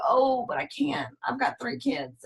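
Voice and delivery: soft whiny excuse voice; mock excuse. A mom telling Jill her excuse, soft and whiny. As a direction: mumble the excuse softly. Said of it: "And you go".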